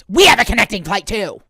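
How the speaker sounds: loud imitation of an annoyed woman; snapping with attitude. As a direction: snap back loudly, mimicking her. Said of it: "with an attitude".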